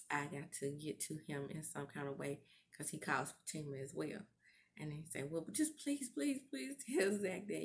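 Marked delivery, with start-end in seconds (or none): 5.3-7.6 high imitated begging; mock plea, pitched up and urgent; pleading. Retold plea for help.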